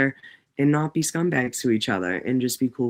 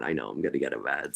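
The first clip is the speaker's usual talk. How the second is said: 1 muttered aside; 13 semitones lower, 8 dB quieter, faster than usual. Real, not acted. Aside about angering Anthony.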